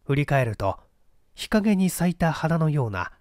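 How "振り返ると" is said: ordinary delivery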